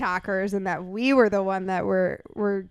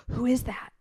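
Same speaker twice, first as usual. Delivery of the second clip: hushed whispered question; 3 dB quieter than usual. Retold or imitated. Fan whispering to her about the stranger, hushed. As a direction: lean in and whisper it.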